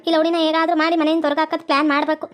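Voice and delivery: put-on low old woman plotting under her breath; scheming mother-in-law. Mother-in-law plotting to throw the girl out, low and scheming.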